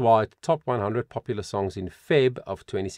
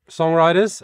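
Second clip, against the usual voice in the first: ordinary talk, nothing marked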